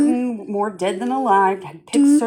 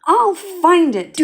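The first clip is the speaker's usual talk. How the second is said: louder, slow determined cry; 7 semitones higher, 4 dB louder, slower than usual. A narrator's reading. Horton vows to the departing eagle, a slow loud cry. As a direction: cry it out with resolve. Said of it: "cried Horton".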